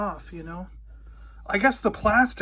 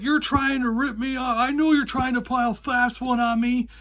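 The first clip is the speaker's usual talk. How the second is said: high, raised accusing voice; angry accusation; 5 semitones higher than usual. Retold or imitated. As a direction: jab the accusation at him. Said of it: "the guy just blows up".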